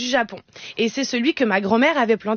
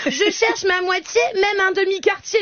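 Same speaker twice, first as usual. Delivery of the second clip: slushy lisp; 8 semitones higher than usual. Pretend. Lemon speaking again.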